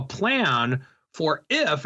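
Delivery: "if" drawn out slowly and weighted; stress on if. Shown as a demonstration. Host urging listeners to plan ahead, slow heavy stress on "if". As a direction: lean hard on "if" and let it hang. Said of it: "I wanna emphasize the word if".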